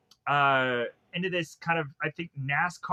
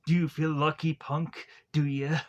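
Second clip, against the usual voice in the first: slightly put-on tough-cop menace; generic tough-guy impression, deliberate pauses; Dirty Harry. Podcaster trying his best at a film cop, generic tough voice. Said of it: "like the Dirty Harry".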